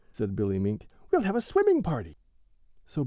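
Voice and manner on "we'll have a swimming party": high, louder and fast; excited plan. A narrator's reading. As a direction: blurt out a fun plan. Billy Mink proposes a party.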